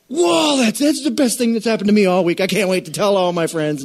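high, loud, gushing delight; a cashier squealing with joy; thrilled cashier. Retold or imitated. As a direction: burst out like the best news all week. Excited cashier quoted.

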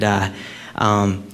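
ordinary talk, nothing marked

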